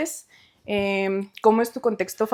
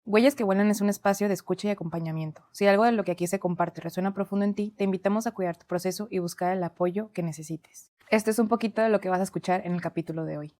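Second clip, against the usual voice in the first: softer, even read of the podcast's legal notice; disclaimer read; 3 semitones lower, 6 dB quieter than usual. Acted. Host reading the episode disclaimer to listeners, soft even read. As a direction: read the fine print calmly, a touch softer.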